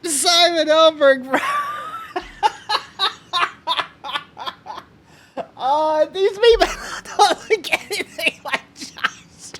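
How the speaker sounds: real helpless laughter, voice squeaking high; barely getting words out through a laughing fit; crying with laughter. Real, not acted. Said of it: "I'm crying".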